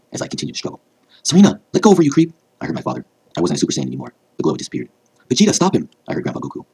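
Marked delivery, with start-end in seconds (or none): 1.2-2.3 very high, loud outraged cry; angry yell. A narrator's reading. Father shouting at the villain choking his daughter, high loud yell. 5.3-5.9 high, loud call; urgent shout. A narrator's reading. Grandfather urging an ally to step in, high urgent shout. Grandfather calls out for help in battle.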